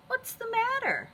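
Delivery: Elmer's question. A narrator's reading. Elmer asks the sad elephants.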